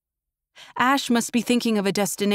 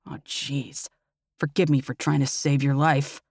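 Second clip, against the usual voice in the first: grunting; 5 semitones lower than usual. Acted. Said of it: "he grunts".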